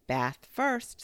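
high, loud cheery motherly call; upbeat and sing-out cheerful. A narrator's reading. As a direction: chirp it brightly, like a bustling mother. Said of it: "said Kanga in a cheerful voice".

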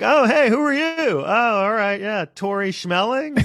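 high-pitched put-on casting agent; sarcastically oblivious, in a high mock voice; mock feigned ignorance. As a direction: play clueless, voice way up high. Mocking casting people feigning not recognizing her.

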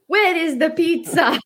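hammed-up Italian accent, pitched high and slow; high, slow mock Italian accent. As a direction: play a frustrated Italian man demanding his pizza in a thick Italian accent. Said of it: "in his little Italian accent".

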